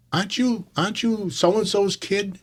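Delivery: lightly acted, high-pitched questioning; probing recognition. Umpire recognizes the berating player.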